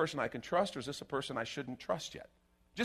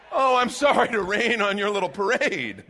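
sarcastic apology; 5 semitones higher, 8 dB louder than usual. Acted.

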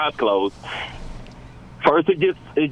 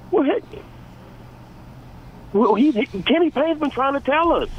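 worked-up rant; 9 semitones higher than usual. Real, not acted. Worked-up rant about coach.

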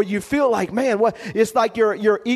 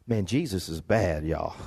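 awed aside; 7 dB quieter than usual. Real, not acted. Preacher's awed aside to congregation.

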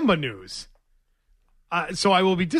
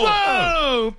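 excited whoa; 12 semitones higher than usual. Real, not acted. Excited exclaim.

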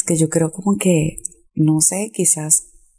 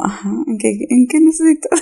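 high, failed attempt at a serious tone; breaking into laughter; 11 semitones higher, faster than usual. Shown as a demonstration. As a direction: start businesslike and crack up. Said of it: "me hace reír".

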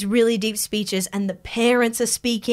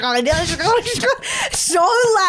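imitated phone video noise; 9 semitones higher than usual. Employee's phone playing over the Zoom call, imitated blaring video. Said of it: "playing a video that's going like".

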